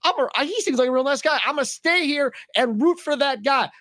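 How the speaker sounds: high-pitched, acted delight of a channel-flipper; enthused TV viewer. Imagined viewer enthused at TV bowler.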